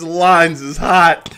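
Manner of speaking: slow, loud, high laughing call; hyped yell about the phone lines; loud slow shout. Real, not acted. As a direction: stretch it out and holler.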